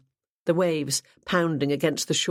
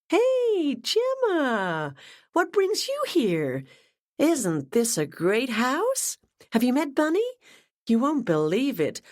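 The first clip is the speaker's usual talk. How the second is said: put-on much higher, bubbly young woman's voice; gushing Ashley; 8 semitones higher than usual. A narrator's reading. Ashley greets her boss Gemma, gushing and much higher. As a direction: gush with delight over the news.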